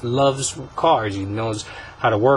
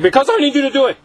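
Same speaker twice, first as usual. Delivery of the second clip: much higher, loud exasperated shout; frustrated yell; 7 dB louder, faster than usual. Pretend. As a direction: cut off his questions with a shout. Car snaps at questioning kid.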